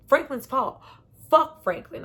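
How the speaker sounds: loud, high mock yell at Franklin; frantic anger. Jerome blaming Franklin, loud mock yell. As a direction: throw the blame out in a retold rage. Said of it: "he is frantic".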